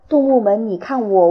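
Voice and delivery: usual speaking voice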